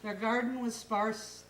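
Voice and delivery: ordinary delivery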